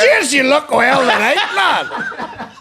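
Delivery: loud, high, drawn-out compliment through laughter; mocking imitation. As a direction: stretch it high and loud, cracking up. Mimics friend's Uber compliment, laughing.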